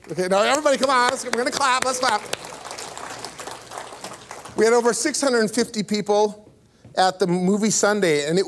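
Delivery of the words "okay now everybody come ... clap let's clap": fast, higher urging to clap; rally the room to clap; urging applause. Real, not acted. Pastor urges the congregation to clap, fast and eager. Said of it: "You can clap".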